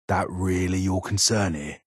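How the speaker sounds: ordinary talk, nothing marked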